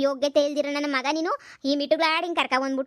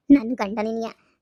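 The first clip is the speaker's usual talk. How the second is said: low, grim echo of the taunt; bitter wife; 5 semitones lower, slower than usual. Acted. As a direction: throw her words back, low and bitter. Wife bitterly repeats the mistress.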